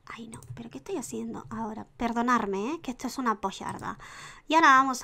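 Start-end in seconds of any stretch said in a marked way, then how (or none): 0.1-1.9 soft whisper at her own blunder; quiet whispered self-scolding; whispered to herself. Real, not acted. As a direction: whisper it to yourself, flustered. Muttering at her own mistake.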